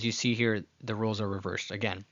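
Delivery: ordinary talk, nothing marked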